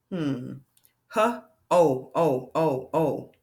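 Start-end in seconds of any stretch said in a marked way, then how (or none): 1.1-3.3 H, then long O repeated in separate beats; sounding out. Shown as a demonstration. Teacher showing a long O sound, sounding it out. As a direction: isolate and repeat "oh". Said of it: "That's making a long O sound".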